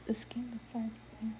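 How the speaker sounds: rereads "besides his inner" under his breath; unvoiced mumble, checking the text; whisper. Real, not acted. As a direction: whispers the line to himself. Rereading a line to himself.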